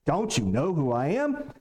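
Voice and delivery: haughty demand, dropped low; a proud man demanding respect; arrogant. Retold or imitated. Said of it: "A little arrogant here".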